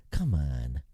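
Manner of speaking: ordinary delivery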